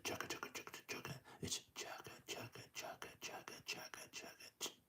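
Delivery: barely audible hushed chugga; soft whispered chugga. Shown as a demonstration. Train creeping up on the deer, whispered chugga. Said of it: "he's gonna go just slow".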